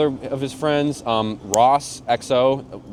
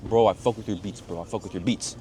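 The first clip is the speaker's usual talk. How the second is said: imitated thick Ukrainian accent; 2 semitones lower, 4 dB quieter than usual. Ukrainian fan praising the producer's beats, thick accent. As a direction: do a Ukrainian fan's heavy accent. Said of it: "like, a thick accent".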